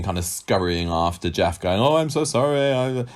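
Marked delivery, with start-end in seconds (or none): 1.8-3.0 high-pitched mock-pleading apology; hammed-up, anxious-to-please sorry; grovelling apology. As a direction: imitate someone abasing themselves as they scurry after him. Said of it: "flagellating herself in front of him".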